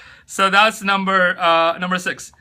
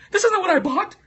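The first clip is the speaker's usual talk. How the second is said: much higher, shrill panicked buyer voice; mock freak-out; 13 semitones higher than usual. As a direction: play a customer panicking over a purchase. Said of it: "you're gonna freak out like".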